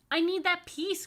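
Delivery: put-on very high and loud child; upset cry. Michael crying out at Rachel, high and loud. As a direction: cry out like a kid robbed. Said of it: "cried Michael".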